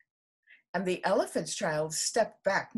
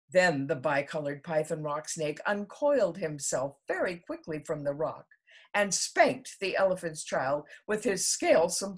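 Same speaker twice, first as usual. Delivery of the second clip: usual speaking voice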